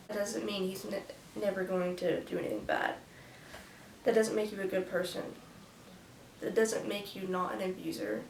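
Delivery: ordinary delivery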